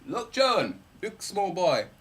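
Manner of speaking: lightly voiced pointing father; a hint of a fond father calling his son; father's voice. Father points out the star in a lightly voiced fond tone. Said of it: "that's how my father talks all the time".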